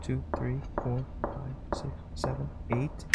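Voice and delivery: whispered counting of the beats. Real, not acted. Player counting claps to the cutscene in a whisper.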